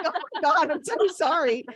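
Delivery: high, loud overdone sorry; mother to crying daughter, loud high apology; frantic apology. Retold or imitated. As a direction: apologize in a rush, half laughing.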